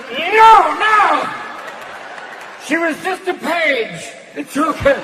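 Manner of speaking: high, loud panicked denial; boss panicking. Retold or imitated. Old boss yelling his denial to the comic. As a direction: protest too much, voice cracking up. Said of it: "And he's going".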